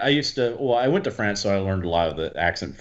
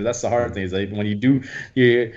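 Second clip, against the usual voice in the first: ordinary delivery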